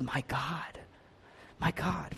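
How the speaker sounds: soft, near-whispered words of trust; hushed quote. Retold or imitated. Preacher quotes Jesus still believing at the end, hushed. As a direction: let it drop almost to a whisper.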